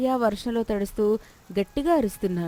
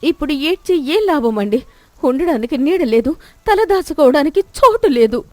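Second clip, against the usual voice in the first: louder, higher bitter reply; bitter despair; 6 semitones higher, 10 dB louder than usual. Acted. Wife telling Suribabu there is no shelter, bitter. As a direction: throw his tears back at him.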